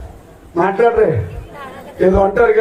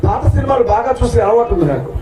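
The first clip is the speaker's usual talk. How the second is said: ordinary talk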